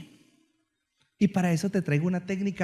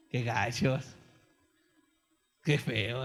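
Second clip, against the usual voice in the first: lower, softer put-on groan of disgust; mock disgust; 7 semitones lower, 4 dB quieter, much slower than usual. Preacher mimics the crowd, mock disgusted groan. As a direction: groan at the joke like the audience.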